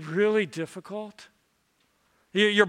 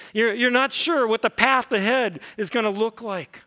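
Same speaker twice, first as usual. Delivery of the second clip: louder, higher, pressing on through the list; rising preaching fervor; 4 semitones higher, 4 dB louder than usual. Acted. Pastor to struggling listeners, mounting fervent urgency. Pastor urges anxious congregation, building.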